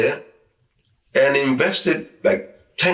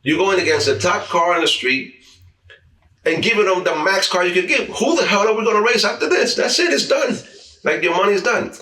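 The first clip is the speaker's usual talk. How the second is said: higher and higher with disbelief at a reckless bet; worked-up disbelief; 7 semitones higher than usual. Real, not acted. Telling the host about a foolish race bet, rising disbelief. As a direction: climb in pitch as the outrage builds.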